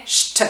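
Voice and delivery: "je te" as the cluster "cht"; hushing "ch" plus t for "je te"; isolated sound. Shown as a demonstration. Teacher showing what "je suis" becomes, isolated cluster.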